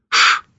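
sharp shushing hiss. A narrator's reading. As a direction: hiss for silence. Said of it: "зашипел маэстро".

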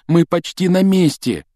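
high-pitched urgent plea; pleading. A narrator's reading. Ron coaxes the failing car, pleading. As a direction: beg it to hold on, voice pitched high. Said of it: "умолял её Рон, дёргая руль".

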